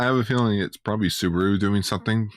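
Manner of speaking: normal talking voice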